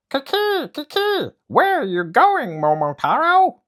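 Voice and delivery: very high, loud monkey chatter. Acted. Monkey drops from the tree to ask Momotaro, high chatter.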